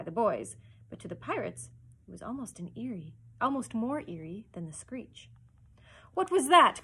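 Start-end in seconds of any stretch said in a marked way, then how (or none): 6.1-6.8 high, loud, sudden demand; startled cry. A narrator's reading. Hook hears the screech from the cabin, alarmed cry. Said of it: "cried Hook".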